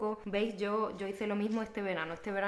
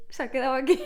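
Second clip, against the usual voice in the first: laughing; 5 semitones higher, 7 dB louder, much faster than usual. Real, not acted. Laughing over kiss mark.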